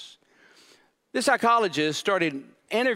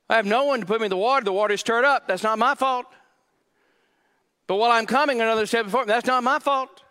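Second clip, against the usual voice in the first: higher and louder, complaining; sulky protest that nothing is his fault; indignant blaming whine; 6 semitones higher, 4 dB louder, faster than usual. Acted. Pastor acting the man blaming God, loud complaining whine. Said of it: "he implicates that it's God's fault".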